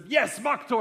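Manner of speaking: high, loud bartender's shout; imitated scream. Storyteller voicing the Viking bartender over burning shots, imitated scream. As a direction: roar it out as a mock toast. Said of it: "he just screams".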